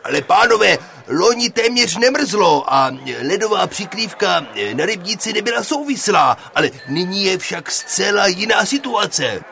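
loud, high, insistent protest. Acted. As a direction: argue back forcefully, well above normal pitch. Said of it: "promlouval učitel Pěnička zvýšeným hlasem".

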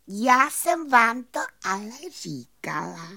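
high, pleased fairy voice; delighted little fairy. A narrator's reading. Fairy telling the doubters so, high and pleased. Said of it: "rozářila se potěšeně plavůňka".

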